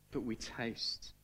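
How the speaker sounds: choked up. Real, not acted. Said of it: "Sorry, I didn't mean to get emotional".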